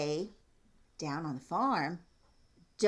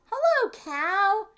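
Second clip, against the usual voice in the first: high, loud chirpy duck greeting; cheery duck; 9 dB louder, slower than usual. Acted. Duck greets the cow, high and loud. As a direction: sing out hello like a happy duck.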